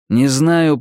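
plain reading voice

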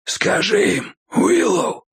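breath-starved, rasping order; pained wheeze. A narrator's reading. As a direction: wheeze it out, rising high on the name. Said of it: "прохрипел он, мучительно скривившись от боли". (хрипя от боли)

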